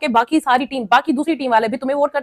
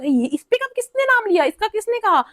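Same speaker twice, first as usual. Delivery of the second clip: much higher, hammed-up indignant challenge; mock angry confrontation; 10 semitones higher than usual. Ashnoor challenging the kitchen group, in a much higher mock-indignant voice. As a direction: demand who took her name, overplayed.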